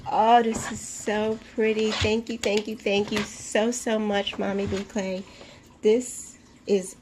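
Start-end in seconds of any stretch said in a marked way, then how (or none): none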